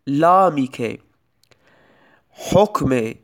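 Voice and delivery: usual speaking voice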